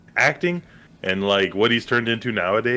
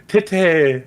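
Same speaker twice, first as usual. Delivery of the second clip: higher, louder mock French exclamation; mock French accent; 8 semitones higher, 5 dB louder than usual. Host mocking a character meant to be French, mock French accent. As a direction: put on a token French accent. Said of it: "we know what a French accent is".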